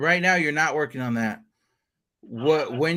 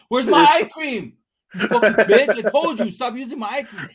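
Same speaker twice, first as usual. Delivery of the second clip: hammed-up yell over stolen eye cream; mock angry yell; 5 semitones higher, 4 dB louder than usual. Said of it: "hearing me yell right now".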